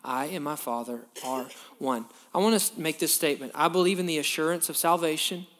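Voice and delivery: ordinary talk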